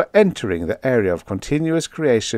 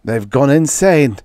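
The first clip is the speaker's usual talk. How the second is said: louder, higher voice of revulsion; appalled disgust; 7 semitones higher, 6 dB louder than usual. A narrator's reading. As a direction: recoil from them as you say it. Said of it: "shrinking back in disgust".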